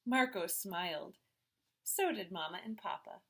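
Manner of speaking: ordinary delivery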